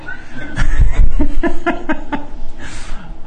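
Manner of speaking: high breathy embarrassed laugh; nervous laugh. Real, not acted. Learning to breathe on stage.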